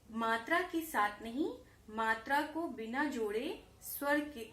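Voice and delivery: usual speaking voice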